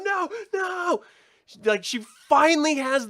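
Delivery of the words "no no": far higher frantic denial; panicked cry. Retold or imitated. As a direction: cry out as the bite sinks in. Ellie freaking out, friend bitten.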